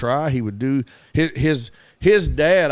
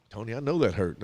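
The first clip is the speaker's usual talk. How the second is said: softer, gently agreeing; soothing coach; 4 semitones lower, 8 dB quieter than usual. Retold or imitated. Coach gently answering the hurt boy, softly. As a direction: ease it out softly to the kid. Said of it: "And I was like".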